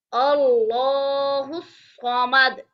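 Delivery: अल्लाहु स्वामद recited slowly, the final द bouncing back; qalqalah on dal. Shown as a demonstration. Teacher demonstrating qalqalah on the final द. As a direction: echo the last द with a slight rebound. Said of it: "दाल के ऊपर हम कलकला करेंगे".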